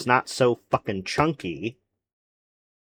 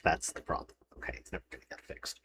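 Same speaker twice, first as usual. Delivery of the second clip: whispered, much softer resigned aside; muttered under breath; 5 semitones lower, 14 dB quieter, faster than usual. Real, not acted. Resigned to the insurance portal, whispering under breath. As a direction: mutter it to yourself, giving up.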